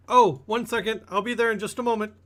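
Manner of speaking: high, hurried call from another room; Mary. Acted. Healer calls back to her visitor, high and hurried. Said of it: "you hear Mary begin to kind of".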